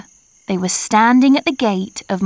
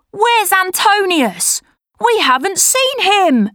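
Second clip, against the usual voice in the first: put-on worried boy; 9 semitones higher than usual. A narrator's reading. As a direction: ask it like a frightened boy. Boy asks about missing friend.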